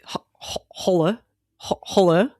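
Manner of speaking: put-on voice phone answer; odd phone greeting. Shown as a demonstration. Podcast host demonstrating how they throw off unknown callers, silly voice. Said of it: "answer the phone like this".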